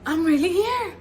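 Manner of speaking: loud, awed realization; amazed. Retold or imitated. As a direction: take it all in. Overwhelmed arrival.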